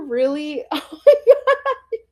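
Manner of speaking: high teary laugh, half crying; emotional laugh. Real, not acted. Vlogger tearing up over her childhood desk, laughing through it. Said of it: "I'm really getting emotional".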